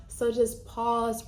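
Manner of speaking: ordinary delivery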